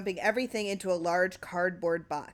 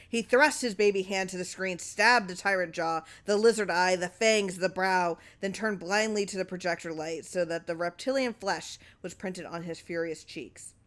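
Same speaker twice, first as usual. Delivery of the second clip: usual speaking voice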